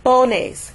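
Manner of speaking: "Pones" with PO high, NES dropping; stress on first syllable. Shown as a demonstration. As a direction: punch the "po" of "Pones". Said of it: "which would be po".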